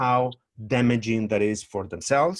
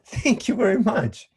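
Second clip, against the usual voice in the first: polite brush-off; 7 semitones higher than usual. Pretend. Dancer politely dodging unwanted feedback, sing-song high thanks. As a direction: brush the critic off with sweet politeness. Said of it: "you will do what? Go".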